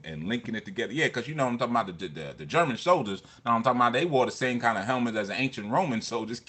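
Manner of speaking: ordinary talk, nothing marked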